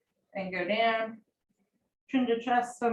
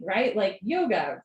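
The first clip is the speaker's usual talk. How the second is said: usual speaking voice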